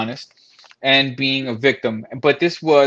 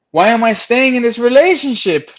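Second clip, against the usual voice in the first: panicked thoughts; 10 semitones higher, 5 dB louder than usual. Retold or imitated. Retelling own panicked thoughts.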